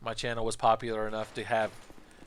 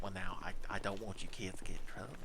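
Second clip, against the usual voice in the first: a hint of a timid teacher, softer and lower; meek teacher; 4 semitones lower, 10 dB quieter than usual. Substitute teacher worrying about the kids, soft and meek. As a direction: mumble it like a shy sub.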